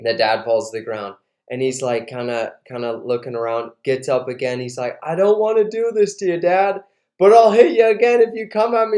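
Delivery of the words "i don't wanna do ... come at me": higher, urgent warning; retold plea turning to a louder threat; pleading warning. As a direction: act out a reluctant son warning him off. Son warns dad off.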